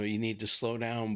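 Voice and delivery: normal talking voice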